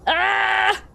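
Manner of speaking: high, loud "ah!"; frustrated yelp. Real, not acted. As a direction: cry out in frustration. Frustrated game search.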